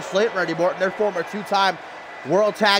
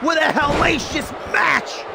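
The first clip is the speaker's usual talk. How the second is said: excited play-by-play; 7 semitones higher, slower than usual. Acted. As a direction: call the action like a ringside announcer.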